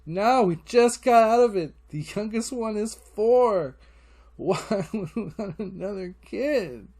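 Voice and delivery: high-pitched, pleading outburst starting loud; acted dismay, voice pushed way up; exasperated protest. Dad refusing girlfriend another baby, exasperated.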